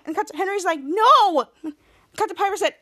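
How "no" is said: loud, high protest; indignant. Retold or imitated. Henry contradicting Piper to Jake, indignant. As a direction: cry out in acted objection.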